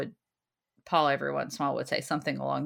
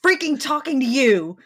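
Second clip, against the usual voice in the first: annoyed; 8 semitones higher, 9 dB louder than usual. Retold or imitated. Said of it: "And I'm like".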